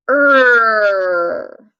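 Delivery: Er as a long loud growled R, tongue bunched back; Er, tight and pulled back; er sound demo. Shown as a demonstration. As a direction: hold the Er like a growling dog. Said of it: "make the er sound with me".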